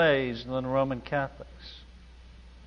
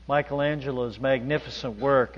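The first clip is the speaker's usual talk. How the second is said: normal talking voice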